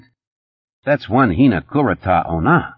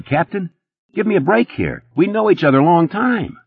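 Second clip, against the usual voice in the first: high, fast-talking nervous hustler; Eddie's voice; 9 semitones higher than usual. A narrator's reading. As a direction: pitch it up as jittery Eddie.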